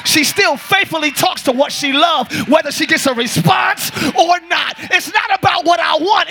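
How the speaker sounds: ordinary talk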